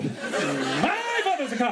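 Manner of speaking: very slow, laughing rehearsal. Pretend. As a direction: stretch it out, savouring each word. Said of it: "I'm doing line readings".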